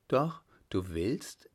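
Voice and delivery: slow, hushed alarmed question; a touch of fright as the mother asks; startled mother. A narrator's reading. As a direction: ask it in shocked disbelief. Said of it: "fragte die Mutter ganz erschrocken".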